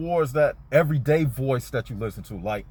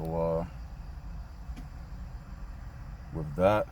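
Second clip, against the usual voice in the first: quiet, dropping voice; 6 semitones lower, 7 dB quieter than usual. Real, not acted. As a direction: voice sinks low and soft, trailing off. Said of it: "the reason why I got kinda quiet like that".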